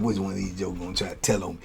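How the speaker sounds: muttered suspicion under the breath; wondering who will snitch, hushed and quick; paranoid inner thought. Retold or imitated. Paranoid inner thought walking upstairs.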